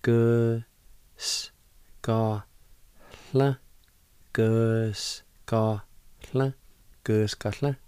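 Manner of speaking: "Gu S, ka, hla" broken into syllables, then joined. Shown as a demonstration. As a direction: sound out "gus, ka, hla" one part at a time. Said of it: "It's pronounced like this".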